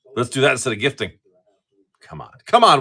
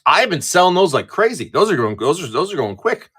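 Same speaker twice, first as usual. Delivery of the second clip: usual speaking voice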